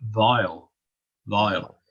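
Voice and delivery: vowel said Northern Irish style as "vial". Shown as a demonstration. Teacher demonstrating the Northern Irish way of saying vowel. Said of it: "people would say".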